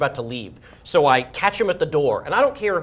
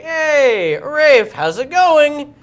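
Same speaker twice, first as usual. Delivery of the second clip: high, loud, stretched-out hello; hammed-up greeting; 11 semitones higher, 7 dB louder, much slower than usual. Man waltzes over to the star, hammed-up greeting. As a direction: hail an old pal across the room.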